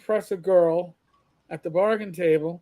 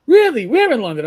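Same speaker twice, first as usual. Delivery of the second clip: imitated London accent, high and loud on the first word; lightly put-on London voice, eagerly questioning; a Londoner quizzing a fellow countryman; 4 semitones higher, 8 dB louder than usual. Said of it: "I knew I heard a North London accent".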